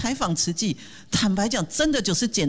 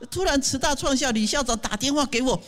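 normal talking voice

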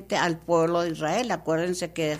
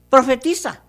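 divine command; 5 semitones higher, 6 dB louder than usual. Acted. God orders the prophet.

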